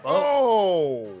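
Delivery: loud pained oh; excited shout. Real, not acted. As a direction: yell out at the hit. Watching fight hit.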